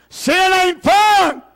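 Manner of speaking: drawn-out shout of Sin ain't fun; preaching shout. Shown as a demonstration. Preacher hammering the point to his church, slow shout. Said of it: "Would somebody say that with me?".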